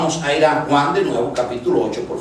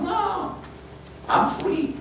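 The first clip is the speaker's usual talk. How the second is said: defiant high voice; 7 dB quieter, much slower than usual. Retold or imitated. Preacher voicing herself brushing off trouble in a high, defiant voice. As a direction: jump way up into a high, far-off voice. Repeats her defiant self-quote.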